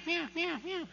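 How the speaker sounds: high, soft mewing meows; cat meows. Retold or imitated. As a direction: mimic a trapped kitten's thin mew. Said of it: "I hear this meow".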